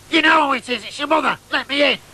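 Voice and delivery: a hint of a cross mother at the door; mum demanding. Mother demanding her son open the door, mildly stern.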